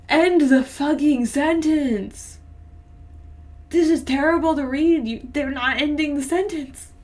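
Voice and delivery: loud, high outburst of frustration; real annoyed yelling, high-pitched; exasperated rant. Real, not acted. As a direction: shout at the writer, fed up. Rants at the bad writing.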